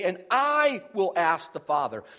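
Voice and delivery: "I" held long and strong; weighty stress on "I"; emphatic I. Shown as a demonstration. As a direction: stretch "I", then move on. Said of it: "There are emphatic pronouns".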